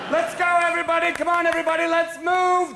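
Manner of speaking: high, loud rallying shout; calling out to a crowd. Acted. Leader herding everybody through the parted sea, rallying shout. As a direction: holler to get everyone moving.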